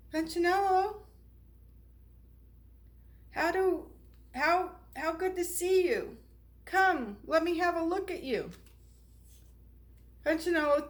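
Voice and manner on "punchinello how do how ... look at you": high, bright welcome; delighted greeting. Acted. Eli welcomes Punchinello, delighted and higher. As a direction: glad to see him at last.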